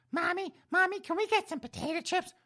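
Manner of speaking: put-on very high squeaky little-kid voice; seven-year-old child. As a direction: pipe up like a kid begging Mommy for a treat. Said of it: "that seven-year-old looked up at Precious and said".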